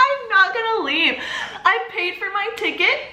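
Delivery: high-pitched protest with a laugh in it; retold indignant squeal; indignant refusal. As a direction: push back in a high, affronted voice. Refusing Rick's request to leave.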